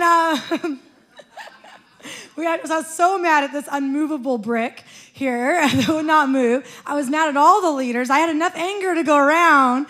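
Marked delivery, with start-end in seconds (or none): none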